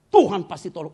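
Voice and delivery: loud, high retold declaration; emphatic assurance. Wife answering her husband who lost his ministry, loud emphatic assurance. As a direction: imitate a firm, loud reply of faith. Retells wife's bold answer of faith.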